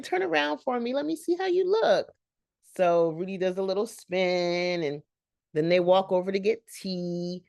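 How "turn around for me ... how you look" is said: high, gushing greeting; sweet Southern belle. Retold or imitated. Whitley greeting Rudy at the tea, high and gushing. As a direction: coo it like a beauty queen. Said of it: "And Rudy says".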